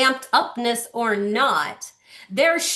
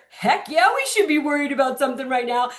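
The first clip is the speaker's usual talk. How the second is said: put-on higher, fast eager baby voice; baby's answer; 3 semitones higher, faster than usual. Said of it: "the answer was very clear".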